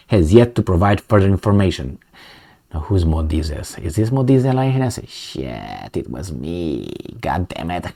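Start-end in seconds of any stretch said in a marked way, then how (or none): 4.9-7.9 low, soft, deadpan curse; a hushed mock groan of being caught; mock caught-out mutter. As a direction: play it like you just got busted, under your breath. Pretends to be caught out.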